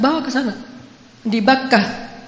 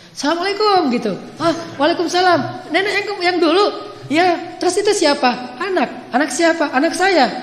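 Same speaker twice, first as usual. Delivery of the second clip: high excited voices; 8 semitones higher than usual. A narrator's reading. Grandmother returns with baby.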